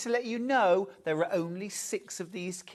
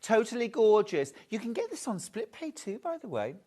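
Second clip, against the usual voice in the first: ordinary talk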